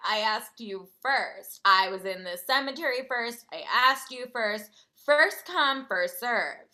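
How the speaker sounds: drawn-out, emphatic retort that builds; insistent bickering. Retold or imitated. Narrator retelling a squabble with this girl, insistent. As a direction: argue back with mock indignation, stretching the words. Retold bickering over who asked first.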